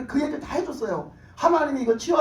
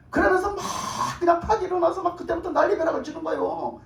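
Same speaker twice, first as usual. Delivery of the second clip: excited retelling; 7 semitones higher than usual. Retold or imitated. Husband goes wild, retold.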